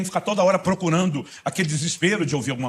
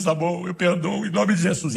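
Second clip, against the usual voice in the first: tearful surrender; 5 semitones higher, slower than usual. Retold or imitated. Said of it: "você se encontra chorando".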